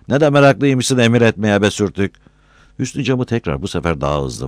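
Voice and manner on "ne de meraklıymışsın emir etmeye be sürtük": raised, loud sneering complaint; irritated grumbling. A narrator's reading. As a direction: snap back at bossy orders. Annoyed insult in acted quarrel.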